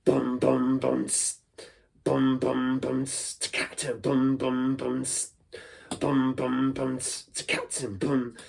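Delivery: bum bum tss beatbox pattern; beatbox variation; beatbox groove. Shown as a demonstration. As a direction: groove on the mouth beat. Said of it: "You could do many different things with it".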